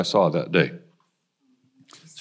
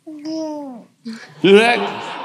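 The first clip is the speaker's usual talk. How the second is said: laughing; much slower than usual. Real, not acted. Laughs delighted at the baby clip.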